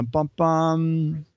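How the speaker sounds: normal talking voice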